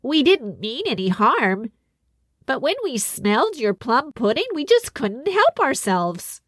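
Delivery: sheepish little troll owning up; small troll apologising to the king. A narrator's reading. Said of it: "said the first little troll".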